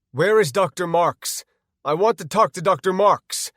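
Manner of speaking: loud, high, frantic shouting; panicked scream. Acted. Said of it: "I screamed in panic".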